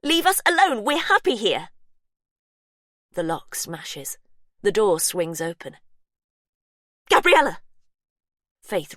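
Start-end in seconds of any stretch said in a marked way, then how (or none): 0.0-1.7 loud, high desperate yell. Acted. Faith yelling at intruder. 7.1-7.6 loud, high shout of a name. Acted. Calling a name on entering.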